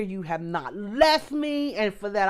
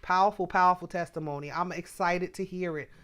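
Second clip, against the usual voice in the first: usual speaking voice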